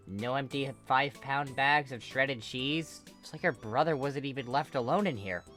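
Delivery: plain reading voice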